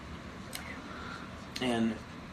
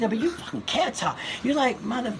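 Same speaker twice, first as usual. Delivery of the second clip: much higher, laughing mock voice; Chris Rock impression; 12 semitones higher than usual. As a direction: impersonate him cracking up mid-tease. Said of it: "He said".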